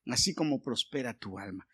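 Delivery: re-reading the missing line quietly; quiet repeat. Shown as a demonstration. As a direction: repeat the verse softly, pressing on "como". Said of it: "Eso es lo que le faltó".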